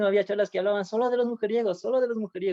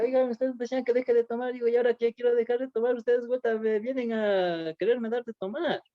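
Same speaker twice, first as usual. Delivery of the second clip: raised, pleading complaint to his friends; higher, hurt voice reproaching friends; 5 semitones higher than usual. Retold or imitated. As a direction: protest that they now push him to drink. Confronting friends who offer drink.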